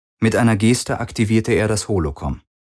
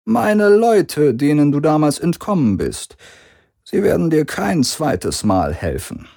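much higher, louder gloating father; 8 semitones higher, 4 dB louder than usual. A narrator's reading. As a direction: crow about it over her. Vader triomfeert.

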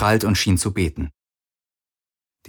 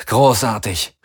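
dry bitter "Großartig" with a falling end; sarcastic; slower than usual. A narrator's reading. Pilot cursing the dead weapons, sarcastic "Großartig". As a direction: say "Großartig" flat and sour. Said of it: "rief er".

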